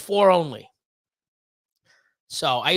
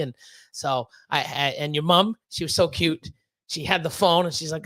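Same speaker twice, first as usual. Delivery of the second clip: ordinary delivery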